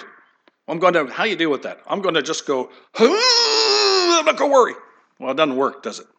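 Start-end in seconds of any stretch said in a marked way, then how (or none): 2.9-4.7 much higher, strained, falsetto resolve; hammed-up high voice of someone forcing himself not to worry; mock forced cheer. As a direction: squeak out a fake brave promise. Mocking forced self-talk.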